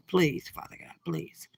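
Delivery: whispered plea to God, barely voiced; a soft pleading whisper, fading away; hushed prayer. Real, not acted. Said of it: "let me hold my mute".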